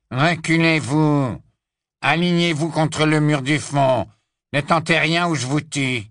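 put-on a scared boy trying to sound tough; trembling Drago. A narrator's reading. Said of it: "la voix tremblante de Drago".

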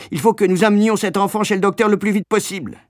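fast, high, pressing order; frantic mother demanding haste; urgent insistence. A narrator's reading. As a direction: rush it out with alarm and authority. Mother urges haste to doctor.

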